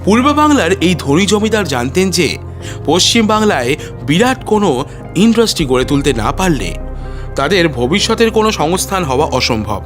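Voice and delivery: ordinary delivery, nothing marked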